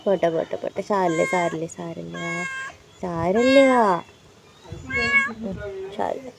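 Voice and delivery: quietly calm the frightened cat; soothing hush. Real, not acted.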